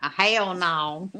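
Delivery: slow, drawn-out Southern twang on "hell no"; long Southern drawl. Shown as a demonstration. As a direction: stretch "hell no" into three words, Southern style. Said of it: "made hell no into three words".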